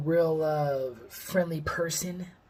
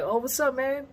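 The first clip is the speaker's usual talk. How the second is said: much higher, thrilled hello; excited greeting; 9 semitones higher than usual. Retold or imitated. Fan answers Chris Webber calling his name, excited. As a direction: greet him like a starstruck kid.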